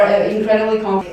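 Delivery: ordinary talk, nothing marked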